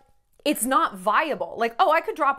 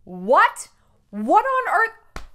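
tearful disbelief; 8 semitones higher, 7 dB louder, much slower than usual. Real, not acted. Crying disbelief.

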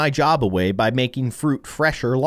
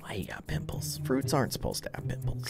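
breathy whisper, much softer; 3 semitones lower, 13 dB quieter than usual. Real, not acted. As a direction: whisper it behind the patient's back. Hushed remark about the fruit.

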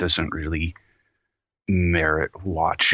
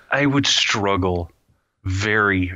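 normal talking voice

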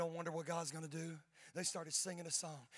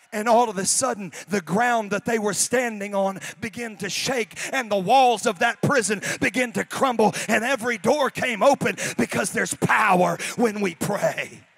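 loud, slow building preacher shout; loud climactic sermon delivery; full-force preaching; 4 semitones higher, 19 dB louder than usual. Real, not acted. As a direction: preach it up to the roof.

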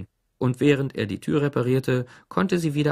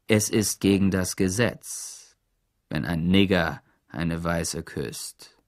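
slow pronouncement; 5 semitones lower, slower than usual. A narrator's reading. Sheriff stating the law, menacing.

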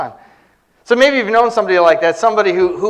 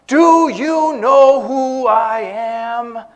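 higher, slow, drawn-out demand; mock demand; 4 semitones higher, much slower than usual. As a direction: imitate a self-important man demanding recognition. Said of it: "that demand".

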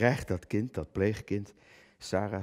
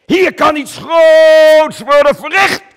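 booming slow proclamation, much higher and louder; grand declaiming shout; 16 dB louder, much slower than usual. Acted. Actor addressing the statue of Coen, declaiming hugely. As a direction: proclaim it to the crowd like a monument.

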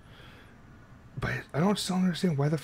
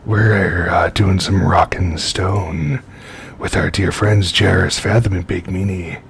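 radio DJ voice; 3 semitones lower, 11 dB louder than usual. Pretend. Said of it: "You sound like a radio D J right now".

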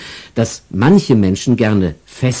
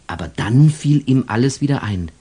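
usual speaking voice

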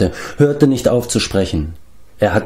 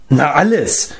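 higher-pitched, testy comeback; gruff retort; 6 semitones higher than usual. A narrator's reading. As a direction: answer curtly, as if it were obvious. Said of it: "gab Glev unwirsch zurück".

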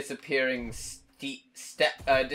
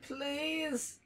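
desperate pleading; 12 semitones higher, 6 dB louder, much slower than usual. Real, not acted. Player begging the game to respawn them, desperate pleading. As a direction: beg in a slow, high whine.

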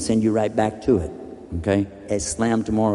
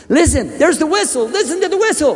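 high, loud, pressing the man to listen; urgent high plea; 4 dB louder than usual. Acted. To the man on the track, urgent high plea. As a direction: insist he hears the whistle. Urging him to hear the whistle.

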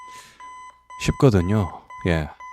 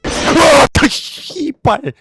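panicking gamer; 6 dB louder, much slower than usual. Real, not acted. Jump scare in horror game.

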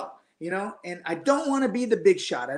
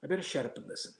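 inner resolve; 4 semitones lower, 11 dB quieter, faster than usual. Retold or imitated. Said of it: "My second instinct was like".

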